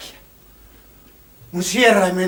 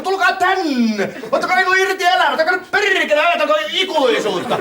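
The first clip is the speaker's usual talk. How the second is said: put-on drunken shout; 10 semitones higher than usual.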